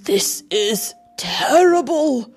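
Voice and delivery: hammed-up dismay, stressing "terrible"; dismayed thought. A narrator's reading. Emperor secretly horrified at seeing nothing, dismayed. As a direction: lift and lean hard on "terrible". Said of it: "thought the emperor".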